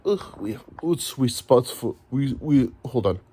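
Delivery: a lightly put-on Russian accent, slow and fumbling; bad Russian accent. Comic imitating a Russian gymnastics coach in a mild Russian accent. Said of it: "I don't think that's a bad Russian".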